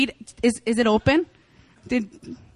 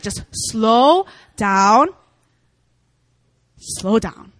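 calm voice of God; much slower than usual. Retold or imitated. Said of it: "But he's like".

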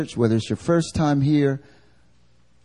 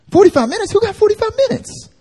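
squeaky, much higher mock shock; mock disbelief; 4 dB louder, faster than usual. Churchgoer balks at forty-five minutes of prayer, in high mock disbelief. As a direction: gasp like it's an outrageous ask.

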